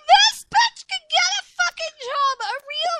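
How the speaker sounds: hammed-up shrill rant about getting a job; mock outraged yell. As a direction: yell it up high like a disgusted friend. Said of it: "I was like".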